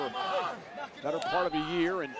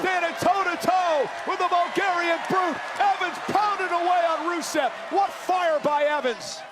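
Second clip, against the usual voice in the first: excited high play-by-play shout; wrestling commentator; 13 semitones higher, 6 dB louder than usual. Real, not acted. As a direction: call the big move, thrilled.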